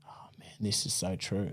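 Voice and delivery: breathy whisper of private realisation; whispered thought. Retold or imitated. Realising the strategy works, a soft private whisper. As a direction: whisper it to yourself, amazed. Inner thought while buying properties.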